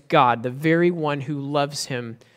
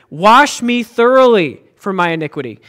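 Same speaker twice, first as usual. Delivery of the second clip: "Blot out my transgression" said loud as an order; urgent command; 5 dB louder than usual. Shown as a demonstration. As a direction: command it, do not ask.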